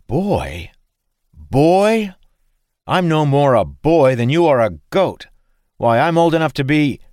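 high, loud offended protest; indignant outburst. Acted. Dalen protests to the farmer, indignant. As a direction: splutter in outrage.

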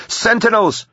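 very high alarm call. A narrator's reading. Eleanor warning the group of red blobs, calling out. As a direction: shout a sudden warning. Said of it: "she called out".

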